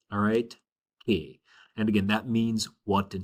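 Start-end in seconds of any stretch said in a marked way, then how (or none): 1.1-1.4 Que said low as one crisp syllable, like the letter K in Spanish; model pronunciation. Shown as a demonstration. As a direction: model Que slowly like "kay" for students to repeat. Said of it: "practice that with me".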